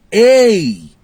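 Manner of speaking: loud high hey, preacher-style; mocking false prophet call. Preacher mocking false prophets' showy "Ei!" call. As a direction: mimic the showy prophet's cry. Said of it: "Já observou isso?".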